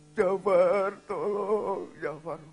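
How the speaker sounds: long, drawn-out loud wail; cry for help. Retold or imitated. Wife being beaten at night, wailing call for help. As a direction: holler the name for help, stretching it.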